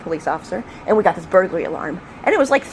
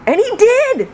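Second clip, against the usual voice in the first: high, loud, astonished report; amazed storytelling; 5 dB louder, slower than usual. Real, not acted. Storyteller amazed the burglar obeyed, excited exclamation. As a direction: exclaim it in happy disbelief.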